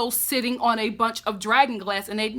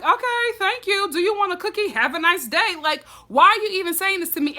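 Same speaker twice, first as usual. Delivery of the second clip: higher, sarcastic mock politeness; sarcastic Jon; 6 semitones higher than usual. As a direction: brush him off with fake thanks. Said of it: "and Jon is like".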